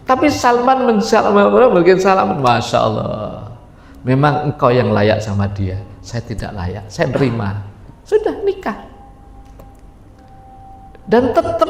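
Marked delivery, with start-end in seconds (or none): 2.4-7.6 gracious acceptance. Acted. Salman graciously yields the bride to Abu Darda, low and soft. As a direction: give way gently and sincerely.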